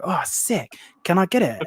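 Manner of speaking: excited. Retold or imitated. Visitor asking keeper for the lizard, excited. Retells eager request to hold lizard.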